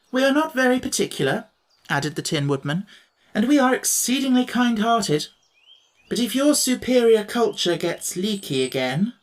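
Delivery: sweet on top, menace underneath; Tin Woodman. Acted.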